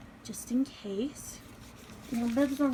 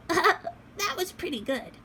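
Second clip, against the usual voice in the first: doll voice; 9 semitones higher, 5 dB louder, slower than usual. Acted. Doll praises mom.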